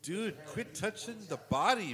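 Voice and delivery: loud, high, stretched-out protest; exasperated scolding. Real, not acted. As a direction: scold the screen in drawn-out exasperation. Scolding the character on screen.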